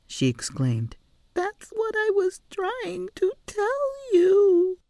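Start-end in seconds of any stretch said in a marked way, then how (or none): 1.3-4.8 high, slow crying voice; upset she wasn't heard; tearful child. A narrator's reading. Girl vindicated, upset.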